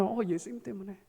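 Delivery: very soft, unforced reply; gentle answer. Shown as a demonstration. Believer answering why they love Sunday worship, very soft and gentle. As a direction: say it quietly, as a simple truth. Modelled answer to a third question.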